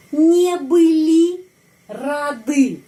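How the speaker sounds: slow, high dictation, не были and рады split by a pause; slow drill. Shown as a demonstration. Teacher modelling a plural negative for learners, slow drill.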